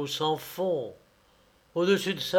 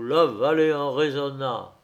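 plain reading voice

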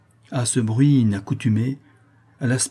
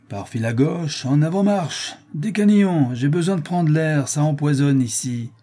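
raised, high-pitched impatient command; brusque marching order; 5 semitones higher, 3 dB louder than usual. A narrator's reading. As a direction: hurry the prisoner along. Bailiff orders prisoner out.

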